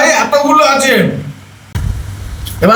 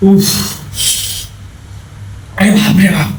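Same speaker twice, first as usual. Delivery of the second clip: gasping exclamations through chili burn; mouth burning from spicy food; 7 dB quieter, slower than usual. Real, not acted. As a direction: groan it out from the heat. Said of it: "কি ঝাল রে". (ঝালে জ্বলে যাওয়া আর্তনাদ)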